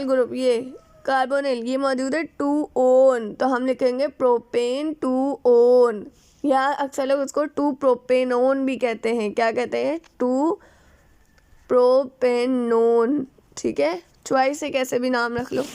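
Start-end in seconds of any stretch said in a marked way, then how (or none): 2.2-6.1 टू ओन stretched out, each part held; slow dictation. Real, not acted. Teacher building the compound's name for students, slow dictation. 10.2-13.3 propanone slowly broken into pro, pen, non; syllable-by-syllable dictation. Real, not acted. Teacher asking what it is called, syllable-by-syllable answer. Teacher sounds out alternative name.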